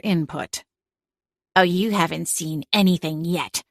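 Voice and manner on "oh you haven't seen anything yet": dark tone. A narrator's reading. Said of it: "her tone dark".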